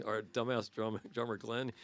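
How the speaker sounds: ordinary talk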